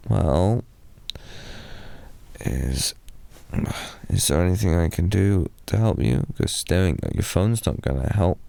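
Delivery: ordinary talk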